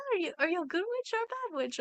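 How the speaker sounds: high breathy good witch voice; lilting Glinda sweetness, high and quick; Glinda impression. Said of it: "the good witch says at this point".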